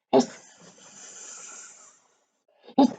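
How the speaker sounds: a soft snake hiss; a quiet hissing sound. Shown as a demonstration. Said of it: "A hiss is a soft sound".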